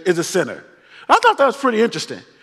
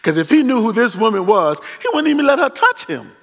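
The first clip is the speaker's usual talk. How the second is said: scandalized Pharisee; 5 semitones lower than usual. Retold or imitated. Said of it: "the Pharisee is like".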